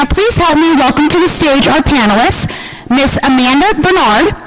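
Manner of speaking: ordinary talk, nothing marked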